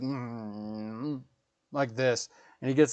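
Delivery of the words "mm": put-on grumpy kid hum. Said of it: "he's like / like this".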